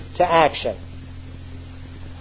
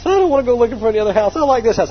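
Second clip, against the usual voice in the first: imitating wife; 5 semitones higher, faster than usual. Wife refusing to house-hunt.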